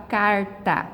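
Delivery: "carta" with southern Brazil's tapped R; southern Brazilian accent. Shown as a demonstration. Teacher showing how her region says carta, southern Brazilian accent. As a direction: say "carta" the southern way, tongue tapping the R. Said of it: "na região sul, a gente vai falar".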